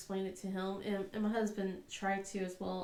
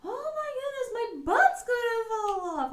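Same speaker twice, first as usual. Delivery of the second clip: mock whining cry; 13 semitones higher, 6 dB louder than usual. Mom voicing son's butt complaint, a crying whine. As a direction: wail it like a fed-up kid.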